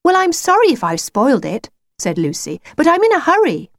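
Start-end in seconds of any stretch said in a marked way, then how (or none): 0.0-1.7 put-on high girl's voice, apologetic; young girl Lucy. Lucy excuses herself to the wolf, high girl's voice. 2.8-3.7 put-on high girl's voice, apologetic; young girl Lucy. Lucy excuses herself to the wolf, high girl's voice.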